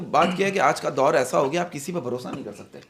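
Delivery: normal talking voice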